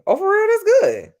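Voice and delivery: high, loud surprised delight; light up at the good news; delighted surprise. Real, not acted. To a viewer about Saturday's weather, high loud happy surprise. Reacting to the warm forecast.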